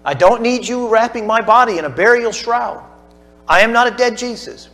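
Jesus speaking. Acted. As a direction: plead with her as the risen Lord. Preacher voices Jesus to Mary.

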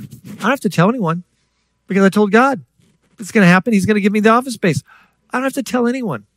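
high-pitched mock stubbornness; reluctant attitude. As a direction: insist airily that it's not your job. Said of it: "I had an attitude like Jonah".

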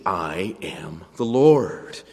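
"I am the Lord" slow, low and firm; emphatic closing refrain. Acted. God ending his sermon, "I am the Lord" slowly emphatic. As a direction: land each word of "I am the Lord." with weight. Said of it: "he ends the sermon with those words".

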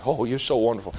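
a hint of a fawning husband; mock praise. Preacher mocking a spouse's flattery, low and sarcastic.

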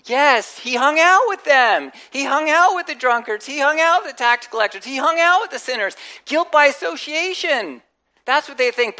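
loud, emphatic preaching, each line hammered. Acted. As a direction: drive each line home to the congregation. Preacher building to emphatic point.

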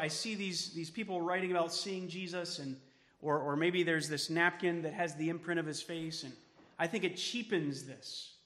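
ordinary talk, nothing marked

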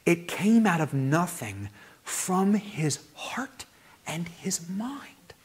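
breathy, slow whisper of awe; slow reverent whisper; hushed whisper. Acted. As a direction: whisper it like a secret of wonder. Awe at God's creation.

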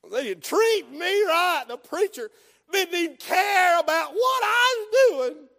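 loud, high, drawn-out mock complaint; hammed-up wounded churchgoer; offended whine. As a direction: wail it like a grievance.

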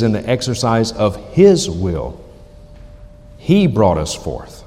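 HIS and He punched, with deliberate pauses; stress on His, He. Shown as a demonstration. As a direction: lean hard on "His" and "He", drawing out the line. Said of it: "notice the emphasis there".

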